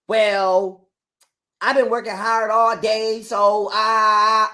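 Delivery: whiny mock of Camille's excuse. Man mocking his wife's excuse about working hard. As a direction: imitate her lazy self-pity. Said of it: "Here come this bitch".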